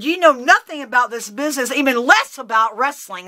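heated, high telling-off; angry tirade. Retold or imitated. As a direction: dress him down with scorn. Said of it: "I just blew up".

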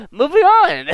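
loud, very high squeal of words; high-pitched call. Pretend. Player skipping ahead in the game, high loud call. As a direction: shoot the voice way up, loud. Abruptly calls out to move on.

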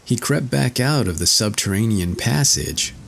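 ordinary delivery

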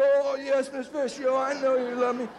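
put-on high, meek boy's reply; timid schoolboy placating his teacher; young boy. Boy answering teacher in story.

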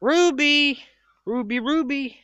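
high, loud call to the dog. Real, not acted. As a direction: call her name across the yard. Calling the dog.

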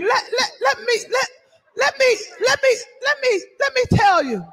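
laughing through the words, stumbling on let me; trying to talk through real laughter; laughing stammer. Real, not acted. Laughing while trying to speak.

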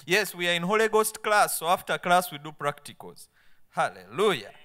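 loud, fired-up rallying call; rally the congregation at full volume; rousing preacher's shout. Real, not acted. Rallies congregation to pray aloud.

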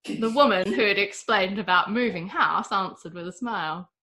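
plain reading voice

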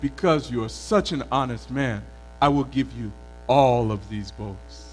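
low, lightly voiced river spirit; pleased spirit. A narrator's reading. Pleased spirit rewards the honest man, low and lightly voiced. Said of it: "The spirit was very pleased".

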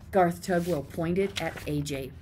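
usual speaking voice

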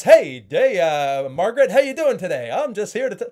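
hammed-up loud salesman greeting; over-the-top loud. Shown as a demonstration. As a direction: blast it way too loud and high. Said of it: "you're not gonna be like this".

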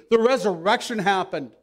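loud, insistent declaration; emphatic plea. Acted. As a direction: drive each word home loudly. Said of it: "Paul made an emphatic plea".

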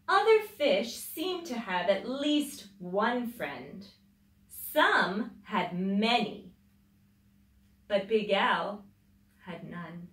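put-on lively read-aloud voice for children; storybook reading. A narrator's reading. Mother reading a picture book to children, lively storybook voice.

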